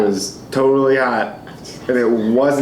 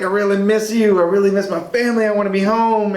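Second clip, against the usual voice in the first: a lightly voiced girlfriend, higher and fast on the middle line; quoting his tearful girlfriend; 7 semitones higher, faster than usual. Telling how his girlfriend begged to come home, lightly voiced higher. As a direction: imitate her homesick plea in a higher voice. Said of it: "She's like".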